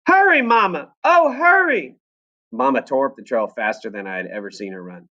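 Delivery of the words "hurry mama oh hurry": high, loud pleading cry; frightened yell. Acted. Daisy yelling again for Mama, high and urgent. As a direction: call out in a panic. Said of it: "Daisy yelled again".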